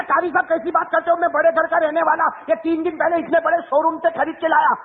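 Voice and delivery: high-pitched indignant protest, lightly voiced; a proud boy defending his new jeans; offended young man. Young man protesting to the preacher that his jeans are new, indignant.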